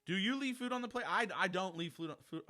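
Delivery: normal talking voice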